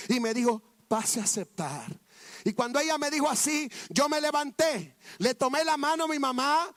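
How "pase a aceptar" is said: gentle urging. Retold or imitated. Teacher's wife gently urges him.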